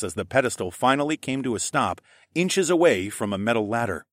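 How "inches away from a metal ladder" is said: ordinary delivery, nothing marked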